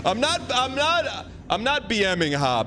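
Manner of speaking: high-pitched, pleading denial; indignant protest. Real, not acted. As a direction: defend yourself insistently, voice jumping way up. Denying that he is mocking Hob.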